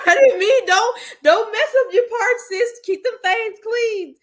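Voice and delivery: high, laugh-cracked pleading; high laughing plea about her parts; laughing squeal. Real, not acted. Laughing apology over braids.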